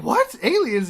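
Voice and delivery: high, loud mock astonishment; shocked disbelief. Breeze hearing about the aliens, astonished. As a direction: yelp it back in disbelief.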